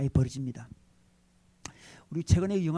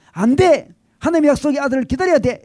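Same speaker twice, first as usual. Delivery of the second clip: firm refusal; 7 semitones higher, 7 dB louder than usual. Pretend. Pastor voices what Abraham should shout.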